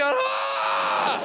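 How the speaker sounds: long high mock gasp-cry; crowd's shock. Whole room seeing the ear held up, mock shocked cry. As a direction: imitate a roomful of horrified people. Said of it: "And the whole room going".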